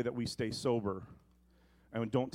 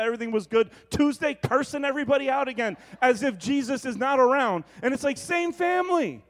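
loud, high, driving sermon voice; full-force preaching; 12 semitones higher, 9 dB louder than usual. Acted. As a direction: preach it hard to the congregation.

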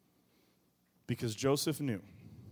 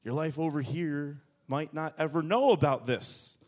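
usual speaking voice

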